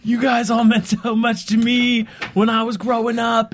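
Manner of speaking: high, slow imitation of tearful gushing; mock crying. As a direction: play a fan choking up, drawn out. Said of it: "and he starts crying".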